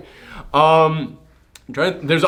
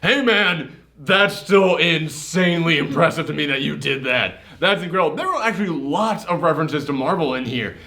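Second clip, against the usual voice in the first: ordinary delivery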